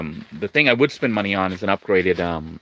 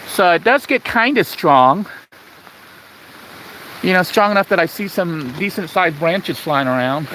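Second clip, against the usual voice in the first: raised voice over the wind; shouting over wind; 8 semitones higher, 3 dB louder than usual. Real, not acted. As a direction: call out loudly over gusts. Outside in strong wind.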